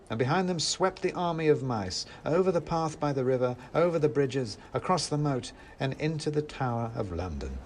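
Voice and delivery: ordinary delivery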